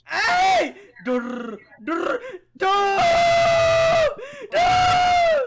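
high, loud frantic durr noises; panicked yelling. Real, not acted. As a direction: yell nonsense in panic.

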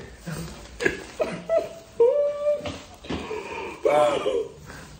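high squealing laugh of relief; breathless high laugh; giddy laugh. Real, not acted. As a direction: let out a long giddy squeal. Leaving dorm, relieved.

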